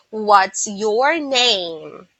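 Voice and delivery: "What's your name" very slow, every word stretched out; prolonged words. Shown as a demonstration. Teacher showing what she avoids, "What's your name" slowly prolonged. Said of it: "I don't prolong the word".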